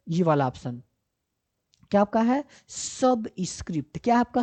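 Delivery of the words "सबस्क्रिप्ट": slow, drawn-out सबस्क्रिप्ट; careful term reading. Shown as a demonstration. Teacher introduces the next option's name to learners, slowly and carefully. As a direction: stretch सबस्क्रिप्ट out for learners to follow.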